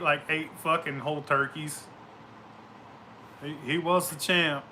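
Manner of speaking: ordinary delivery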